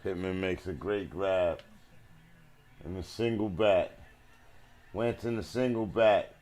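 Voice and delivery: normal talking voice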